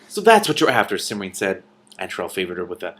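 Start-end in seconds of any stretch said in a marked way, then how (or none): 0.1-1.0 sharp accusation. Acted. Cimmerian confronting Antrell.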